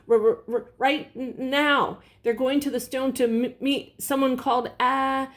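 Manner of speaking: high stammer through chattering teeth; too cold to get words out. A narrator's reading. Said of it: "through chattering teeth".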